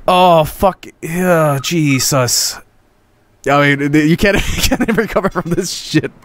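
ordinary delivery

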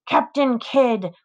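slow sing-song taunt on Captain. A narrator's reading. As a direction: stretch Captain Kidd out mockingly. Frankie taunting with pirate name.

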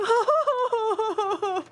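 high, loud teary giggle. Retold or imitated. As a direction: giggle as if overcome. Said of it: "for me, I'm just like".

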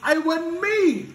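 very high, slow whiny denial; student denying it to the teacher, very high; denying kid. Retold or imitated. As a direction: plead innocence in a squeaky voice. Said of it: "Kevin was like".

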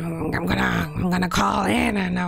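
garbled, gibbering goblin voice; goblin mode. Pretend. Streamer as a goblin calling Anna for a date, goblin mode. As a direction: play a silly goblin pestering someone to go out. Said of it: "I'm gonna be Goblin mode right now".

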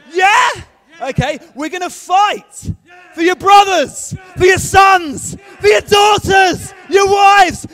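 full-throated, high, drawn-out war cries; shouted battle speech. Acted. Nehemiah sends fighters to defend families, all-out shouting. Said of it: "we'll shout "Sparta!".

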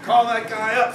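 loud, high order; admiral ordering. Retold or imitated. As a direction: bark it out like an admiral. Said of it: "says the admiral".

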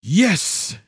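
gleeful hiss on a long S; hissed yes. A narrator's reading. Daughter cheering the bumper car plan, hissed glee. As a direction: hiss it with triumph. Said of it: "Emily hissed".

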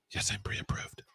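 breathy whispered reply. Pretend. Robot asks about a mortgage, buyer whispers back. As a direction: whisper the answer like a shy buyer.

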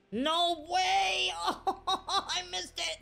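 high-pitched laughing outcry; laughing disbelief. Real, not acted. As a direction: cry out in disbelief through laughter. Laughs at his own miss.

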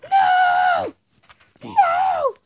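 high, loud put-on whine; mock wail. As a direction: wail it like a spoilt child. Mock wailing refusal.

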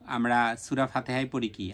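ordinary talk

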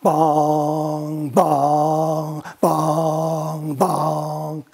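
booming bong knock sounds; knocking sound effect. Acted. Knocking on the dragon's door, booming bong. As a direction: voice the door knocker, slow and heavy.

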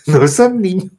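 laughing through the words; amused laugh. Real, not acted. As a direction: giggle as you say it. Laughing at her own joke.

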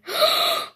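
high gasp of surprise; sharp gasp. Pretend. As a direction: gasp in amazement. Sees a computer.

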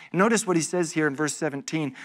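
normal talking voice

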